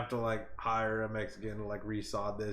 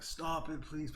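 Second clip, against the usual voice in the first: put-on soft, higher little-kid plea; little kid pleading; 4 semitones higher, 8 dB quieter, slower than usual. Mocking complainers as little kids over there, pleading in a kiddie voice. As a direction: beg quietly like a small child. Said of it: "They're like little kids over there".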